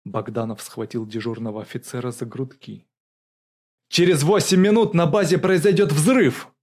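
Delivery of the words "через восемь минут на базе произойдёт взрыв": loud, high, urgent shout. A narrator's reading. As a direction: shout a warning of disaster. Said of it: "крикнул он". (крик, срочное предупреждение)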